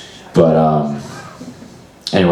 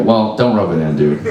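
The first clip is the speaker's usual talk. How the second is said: usual speaking voice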